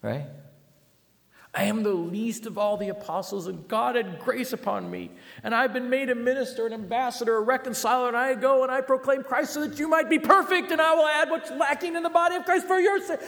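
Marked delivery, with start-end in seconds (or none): 1.5-13.2 much higher and louder, building to a laugh; over-the-top Paul. Retold or imitated. Preacher mocking Paul's boastful letters as over the top. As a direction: ham up Paul's grand boasting. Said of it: "You're a little over the top".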